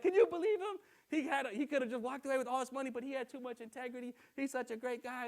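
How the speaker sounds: high, amazed praise with a laugh; lightly voiced impressed woman; gushing cashier. Lady gushes to the girl.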